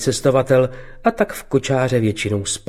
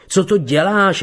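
higher, startled cry out; a mild narrated outcry, higher; 7 semitones higher than usual. A narrator's reading. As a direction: protest as the blows land. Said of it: "vykřikl".

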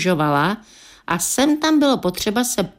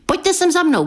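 high-pitched call, mildly raised voice; eager, pitched-up narrated call; 11 semitones higher than usual. A narrator's reading. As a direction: call them over from a little way off. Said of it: "křikl jsem".

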